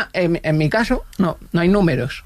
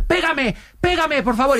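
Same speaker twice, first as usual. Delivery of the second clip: high, pleading imitation of a panicked man; desperate begging; 9 semitones higher, slower than usual. Neighbour stuck in the elevator begging to be slapped, desperate. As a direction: beg for the slap as if it is the only way out.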